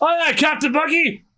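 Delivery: high-pitched, eager pirate reply; crew yelling assent. Acted. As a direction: call back to the captain in unison. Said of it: "While they're all yelling".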